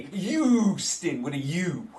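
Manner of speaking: "Houston" as YOO-ston, first syllable stretched long; slow, deliberate "Houston" with a leading Y sound; New York pronunciation. Shown as a demonstration. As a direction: draw out the YOO in "Houston", dropping the H. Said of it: "that's how I say it with my New York accent".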